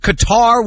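Qatar stressed on the second syllable, "kuh-TAR"; shown pronunciation. Shown as a demonstration. TV host showing name changes, Qatar said "kuh-TAR". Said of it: "different pronunciations".

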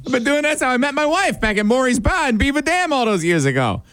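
high, eager mock Boston accent; bad Boston accent. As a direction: show off a hammed-up Boston accent. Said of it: "That doesn't sound like a Boston accent".

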